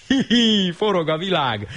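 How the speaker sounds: high giddy whoop. Acted. Old horse whooping while tumbling. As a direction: squeal it with glee.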